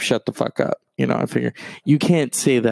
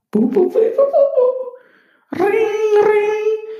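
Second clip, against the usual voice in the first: very high, loud beeping; imitating a phone call connecting; phone dial tones; 5 dB louder, slower than usual. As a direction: mimic a ringing phone.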